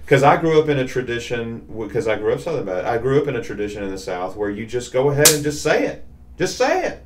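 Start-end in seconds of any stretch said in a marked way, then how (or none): 6.4-7.0 emphatic high repeat. Real, not acted. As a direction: fling "say it" up high and bright for emphasis.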